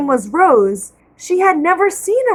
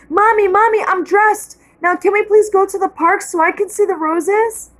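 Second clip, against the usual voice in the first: put-on higher-pitched child bursting to go; excited little girl; 7 semitones higher than usual. A narrator's reading. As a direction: call to mom like an eager kid. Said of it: "said Rose".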